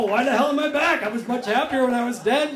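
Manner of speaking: loud, high, mock-whiny ghost of Poe; complaining ghost. Storyteller voicing Poe's ghost, loud and mock-whiny. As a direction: ham up the grumpy returned spirit.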